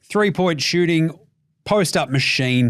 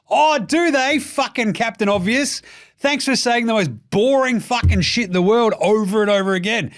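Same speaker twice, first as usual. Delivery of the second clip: mock rant; 7 semitones higher than usual. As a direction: tear into Captain Obvious. Ranting at the pundit.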